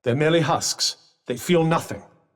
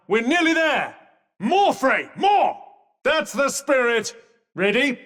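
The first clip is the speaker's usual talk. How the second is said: high, excited urging; pitched-up, eager rallying cry; rousing encouragement; 6 semitones higher than usual. Acted. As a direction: cheer them through the last push. Urging Frey on in combat.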